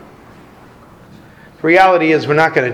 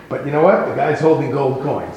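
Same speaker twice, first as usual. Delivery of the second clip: ordinary talk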